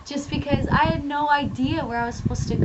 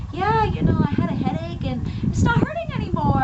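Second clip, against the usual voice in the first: higher-pitched mock of a blasé testifier; casual, relaxed testifier; 6 semitones higher than usual. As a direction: imitate someone shrugging off a healing, pitched up. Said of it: "they're all relaxed and they walk up".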